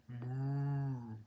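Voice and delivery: long, slow drawn-out moo; cow moo imitation. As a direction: stretch the moo like a lazy cow. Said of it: "makes a sound like".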